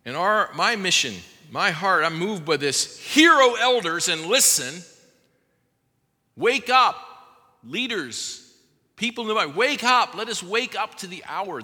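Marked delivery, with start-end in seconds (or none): 3.0-11.6 louder and higher, rousing call; preaching call. Real, not acted. Preacher calling leaders to wake: loud rallying cry. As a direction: summon the elders with full force.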